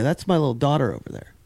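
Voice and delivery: usual speaking voice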